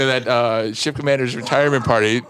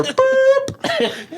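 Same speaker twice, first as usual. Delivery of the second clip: high-pitched "boop"; imitated PA tone. Storyteller mimics the station alert before an announcement, high electronic beep. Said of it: "all of a sudden I hear".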